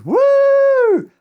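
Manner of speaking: loud, high whoop of delight; cheering crowd. Retold or imitated. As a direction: whoop like the whole table cheering. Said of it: "And everyone goes".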